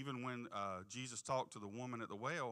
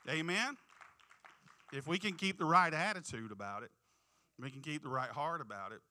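loud, high fervent preaching; rising preacher's call; 4 semitones higher, 8 dB louder than usual. Real, not acted. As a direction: lift the crowd up. Preaching at full force.

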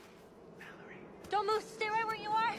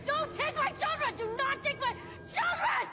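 high, louder terrified pleading; desperate plea; 7 semitones higher, 4 dB louder than usual. Acted. Mother begs the creatures to spare her children, high and loud. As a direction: beg for the children at the top of the voice.

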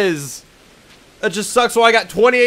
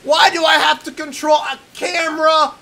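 loud, higher, furious rant at the game; real angry yelling; 5 semitones higher, 4 dB louder than usual. Real, not acted. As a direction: yell at the screen in frustration. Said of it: "This is really making me angry".

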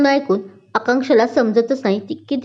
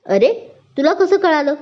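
raised pitch, taken aback; mild astonishment, voice lifting high; surprised; 6 semitones higher than usual. A narrator's reading. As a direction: ask in amazement. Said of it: "नवल वाटतं".

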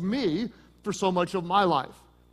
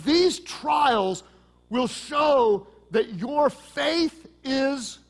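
preaching shout; 5 semitones higher, much slower than usual. Real, not acted. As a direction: stretch it out like a pulpit cry. Preaching climax on scripture quote.

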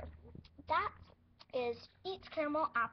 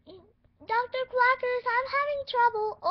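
slow, much higher, much louder call; calling for help; 10 semitones higher, 14 dB louder than usual. Pretend. Nurse calling doctor for help.

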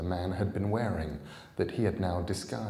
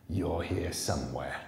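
growled. Acted. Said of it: "growled the man Jack".

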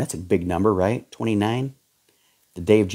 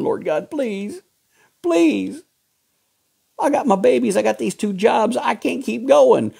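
desperate praying mother; 12 semitones higher than usual. Retold or imitated. Preacher voicing a struggling mother begging God, pleading high. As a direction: beg God like a worn-out mother of two.

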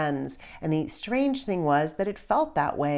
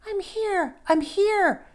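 faint distant reply; 4 dB louder, slower than usual. A narrator's reading. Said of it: "George heard a faint voice".

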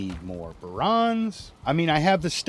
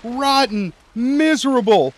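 mock angry shout; 12 semitones higher, 8 dB louder than usual. Gamer cursing the lizard that sank his boat, mock fury. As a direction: drag out each insult at full volume.

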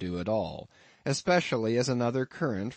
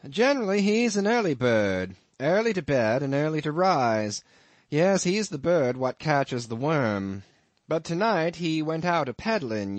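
a lightly voiced innkeeper, higher and a bit louder; amused landlord; 6 semitones higher, 5 dB louder than usual. Innkeeper teasing his lodger about the harpooneer, lightly voiced. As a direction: spin the tale with a sly chuckle underneath.

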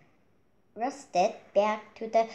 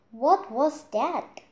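high, loud, slow puzzled question; startled question; 6 semitones higher, 4 dB louder than usual. Acted. Chick puzzled after the acorn falls, startled. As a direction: wonder aloud what just hit you.

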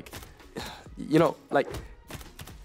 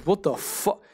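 surprised; 8 semitones higher, slower than usual. Real, not acted. Sudden shock during the game.

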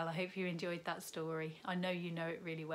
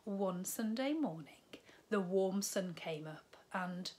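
lively storybook read-aloud; bright children's story narration; 7 dB louder than usual. A narrator's reading. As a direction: tell it like a bedtime story to little ones. Reads picture book to children.

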